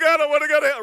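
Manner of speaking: high, loud panicked plea; mock terrified cry; scared teen. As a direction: blurt it out like a frightened kid. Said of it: "And you're like".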